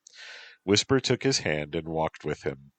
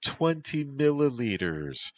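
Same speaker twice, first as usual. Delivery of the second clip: high, pleading creature voice; urging; 10 semitones higher than usual. A narrator's reading. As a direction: wheedle it up high. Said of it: "Whisper urged".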